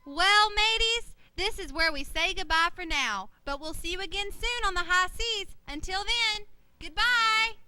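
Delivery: loud, bright pirate farewell; cheerful sign-off. Acted. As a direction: wave the crew goodbye cheerfully. Host signing off pirate show.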